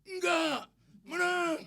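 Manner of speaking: high, loud gleeful yell; imitated children's shouting. Children answering the preacher, high loud shout. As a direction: yell it back like an excited kid. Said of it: "teriak-teriak".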